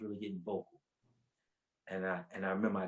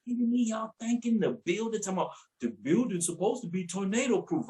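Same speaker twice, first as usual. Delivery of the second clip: snapping at everyone; 10 semitones higher, 5 dB louder than usual. Retold or imitated. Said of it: "So I snapped".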